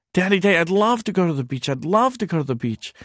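put-on high, quick little boy voice; excited child. Son answering his father about the beach, high and eager. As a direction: bubble over like a thrilled kid.

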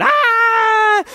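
very high, very loud shriek; piercing storybook scream; terrified scream. A narrator's reading. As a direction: scream in fright at the bears. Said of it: "she went".

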